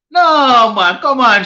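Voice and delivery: sudden high, loud burst of disbelief; loud, high no come on; outraged protest. Real, not acted. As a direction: shoot the comment down at once. Protesting a viewer's comment.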